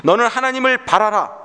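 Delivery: high-pitched declaration of faith; preacher proclaiming a command, voice lifted high; firm declaration. Acted. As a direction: declare it to your own soul, resolutely. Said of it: "선언하는 것입니다".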